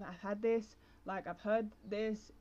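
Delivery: ordinary delivery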